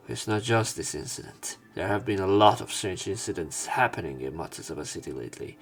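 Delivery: normal talking voice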